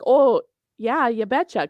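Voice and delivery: chirpy Minnesota "oh yeah, you betcha"; Minnesota accent. Retold or imitated. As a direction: Upper Midwest twang, sing-song. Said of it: "my Minnesota accent comes out".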